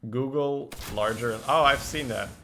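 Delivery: normal talking voice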